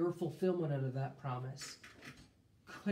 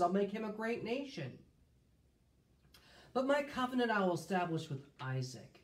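scripture reading; 4 semitones higher, 3 dB louder than usual. Acted. Reads God's words in scripture.

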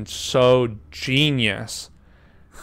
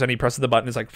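ordinary delivery

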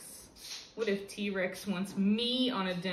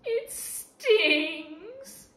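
high, loud whine; whimpering; 10 semitones higher, 9 dB louder than usual. Acted. T-Rex whimpers to the kid about soap in his eyes. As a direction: whine like a hurt child. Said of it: "he whimpers".

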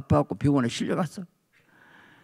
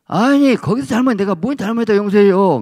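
indignant protest; 7 semitones higher, 6 dB louder than usual. Retold or imitated. Indignant objection to pastor.

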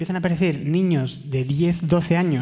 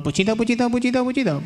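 higher-pitched repeated rapper catchphrase; 5 semitones higher than usual. Retold or imitated. Comedian imitating the rapper he met, pitched-up impression. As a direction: chant it as a quick celebrity impression. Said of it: "Y él me dijo".

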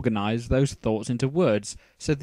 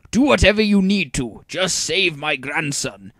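pleading grandfather; 6 semitones higher, 7 dB louder than usual. A narrator's reading.

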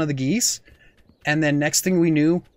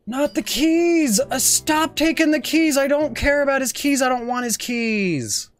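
frustrated outcry; 8 semitones higher than usual. Real, not acted. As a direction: beg the game to stop. Frustrated at game grabbing keys.

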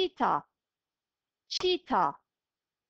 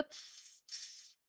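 whispered hissing like a snake; soft snake hiss for children; 26 dB quieter than usual. Shown as a demonstration. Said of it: "Yılan nasıl ses çıkarıyor?".

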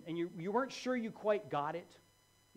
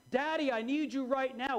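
put-on child's cry; 6 semitones higher, 4 dB louder than usual. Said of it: "when that cry comes".

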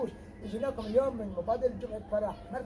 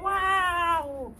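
drawn-out wow; 5 semitones higher, 6 dB louder, much slower than usual. Acted. Host cheers each game move.

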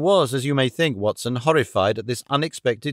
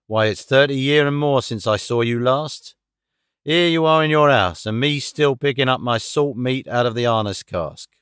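familiar seaman's voice, stressed words punched out; an old sailor greeting a former shipmate. Acted.

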